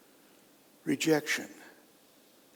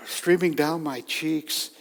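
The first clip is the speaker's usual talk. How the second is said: ordinary talk, nothing marked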